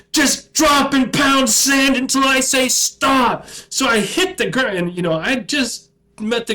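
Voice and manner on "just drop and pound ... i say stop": slow, high, shouted command; drill sergeant shout. Retold or imitated. Drill sergeant shouting at the recruit to do push-ups. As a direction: shout the punishment order.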